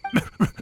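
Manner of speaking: short, breathy mocking laugh; scoffing laugh. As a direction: laugh off the idea of needing help. Scoffing at being released.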